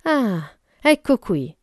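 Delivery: a touch of loud satisfaction; cry of satisfaction. A narrator's reading. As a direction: exclaim as if you've found it. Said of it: "lanciò un grido di soddisfazione".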